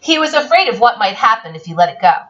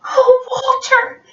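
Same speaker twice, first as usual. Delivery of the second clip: high, slow plaintive sigh of a line; hammed-up sorrowful little voice; unhappy child; 13 semitones higher, slower than usual. A narrator's reading. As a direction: lament to the dog like a sad child. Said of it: "looked at them unhappily".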